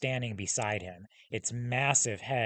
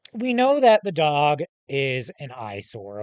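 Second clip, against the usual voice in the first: ordinary delivery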